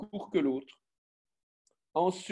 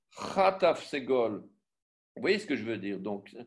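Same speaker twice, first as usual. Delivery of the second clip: usual speaking voice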